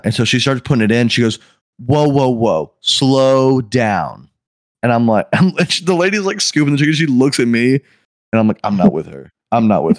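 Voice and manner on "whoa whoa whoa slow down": mock fussy customer halting someone, dragged out; slow-ass talking. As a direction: draw each word out very slowly. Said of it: "like, slow ass talking".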